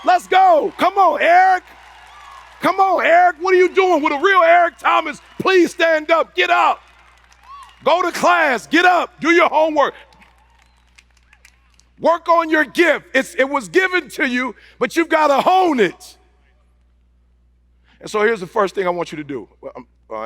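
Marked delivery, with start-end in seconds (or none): 0.0-0.7 louder rallying shout. Retold or imitated. Repeated rallying cry. 0.8-16.2 fired-up shout. Retold or imitated. Preacher to his younger self, shouting him awake.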